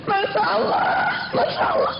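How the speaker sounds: high wail dying to a whimper; mock crying. Mother joining her daughter's tears, mock crying. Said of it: "ibunya ikut menangis sekali".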